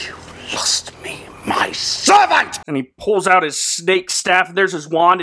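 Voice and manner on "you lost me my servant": strained, breathy villain shout-whisper; villain hiss. Retold or imitated. Villain hisses at the kneeling hero, slow and menacing. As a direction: spit it out in a slow evil hiss. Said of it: "he goes".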